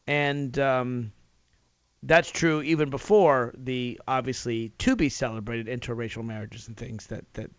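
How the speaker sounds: ordinary talk, nothing marked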